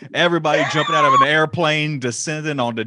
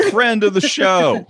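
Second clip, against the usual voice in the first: normal talking voice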